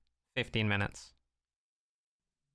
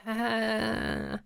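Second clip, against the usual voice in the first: unimpressed ah; 9 semitones higher than usual. Retold or imitated. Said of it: "and I'm like".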